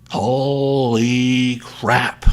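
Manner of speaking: loud, long drawn-out amazement; stunned disbelief. Real, not acted. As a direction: stretch it out loudly, floored by the news. Stunned reaction to breaking news.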